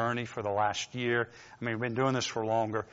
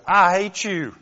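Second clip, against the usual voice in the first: mock angry coworker; 5 semitones higher, 6 dB louder, slower than usual. Coworker's angry call retold.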